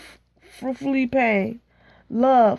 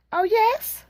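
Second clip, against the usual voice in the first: much higher, eager "Oh, yes?"; a friend perks up at gossip; curious gossip; 10 semitones higher than usual. Acted. Friend eagerly reacting to news of Mister Henry, high pitch. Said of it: "Her friends do not hide their curiosity".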